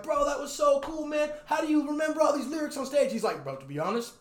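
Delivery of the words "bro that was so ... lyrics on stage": excited fan. Retold or imitated. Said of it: "I was like".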